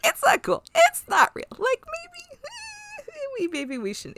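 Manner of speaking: very high squeaky imitation of Riker; high mock Riker. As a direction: squeak it out like a flustered man. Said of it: "He's like".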